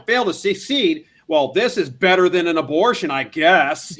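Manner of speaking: loud, snide mock troll review; hammed-up nasty troll. Mocking a troll reviewer, loud and snide. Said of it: "being a troll and be like".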